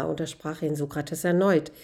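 plain reading voice

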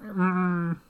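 high, closed-mouth cow lowing. Shown as a demonstration. Showing how a cow really sounds, long hummed moo. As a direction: hum a long moo through the nose. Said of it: "Except it's more like a".

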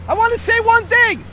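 high, loud hail to a stranger; calling out. Real, not acted. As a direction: call across the street for attention. Calling out to a passer-by.

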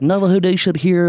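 mock radio call.

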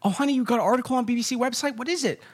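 high, fast excited wife. Retold or imitated. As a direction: squeal it like an eager spouse. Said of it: "his whole article is".

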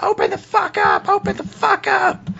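falsetto demand at a locked door; very high mock yell; Clarice yelling. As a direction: squawk it in frantic falsetto. Game master plays Clarice kicking door.

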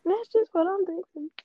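high mocking parrot of her words; mocking imitation. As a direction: mimic her line in a high teasing voice. Friend mocks the line back.

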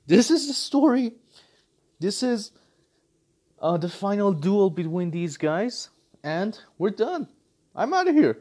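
mock announcement. Studio admitting the last film, breezy high mock tone. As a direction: play the studio finally giving up. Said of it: "just come out and say that".

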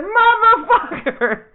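long, slow, high, laughing curse; drawn-out laughing motherfucker. Retold or imitated. Beaten by fiancee's comeback, a slow, laughing curse. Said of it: "I said".

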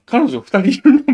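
high, loud disbelieving question; a girl's amazed question about two girlfriends; incredulous question. Retold or imitated. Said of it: "みたいな".

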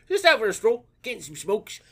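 lightly voiced mock Cockney stroll; a possum chatting in Cockney. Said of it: "A cockney possum".

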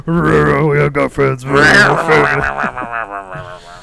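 slow, loud, gravelly movie growl; deep raspy voice. Pretend. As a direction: growl it slow like a tough guy. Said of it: "You gotta say it in a deep, raspy voice".

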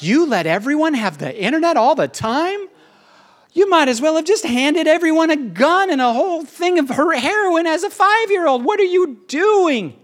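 high-pitched, incredulous scolding; appalled great-great-grandkid. Retold or imitated. Future great-great-grandkids scolding their ancestors, appalled and incredulous. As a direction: wail the reproach in mock outrage.

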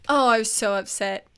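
ordinary talk

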